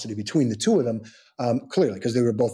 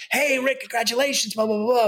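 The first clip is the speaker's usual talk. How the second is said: much higher mock voice of gushing guests; gushing well-wishers; 11 semitones higher than usual. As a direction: toss it off like a chirpy crowd. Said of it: "and they're like".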